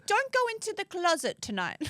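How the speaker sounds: high, a touch of mock warning; wife joking to husband; teasing wife. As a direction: tease him with a playful warning. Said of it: "You're like".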